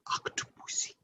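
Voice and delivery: put-on whispered French-accented Octopussy; Louis Jordan impression. Repeating Louis Jordan's whisper to savor it. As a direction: breathe the word Octopussy out softly. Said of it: "the way he says it with his accent".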